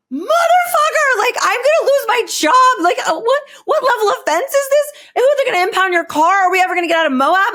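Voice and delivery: high, loud, near-tears wail that speeds up at the end; reliving the freakout over losing her job; retold panic. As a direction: wail it like your life is over. Panic at the park ranger arrest.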